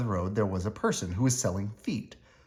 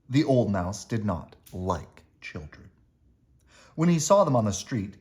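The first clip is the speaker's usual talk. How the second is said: ordinary delivery, nothing marked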